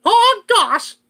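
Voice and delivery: high, loud cartoon mouse exclamation; Mickey Mouse voice. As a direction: squeak it like Mickey at the parks. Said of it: "I sometimes do that to Mickey".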